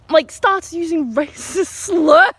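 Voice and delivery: slow, high words breaking into laughter; amused and incredulous; laughing in disbelief. Real, not acted. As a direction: struggle to tell it through giggles. Laughs while telling the outrage.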